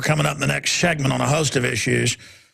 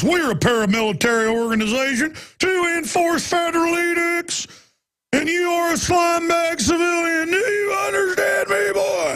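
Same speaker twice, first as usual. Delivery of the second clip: much higher, shouting mock cop; mock police bully; 12 semitones higher than usual. Host mimicking police talking down to citizens, high mock shout. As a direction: bark it as an arrogant cop bullying a civilian. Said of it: "that is the attitude".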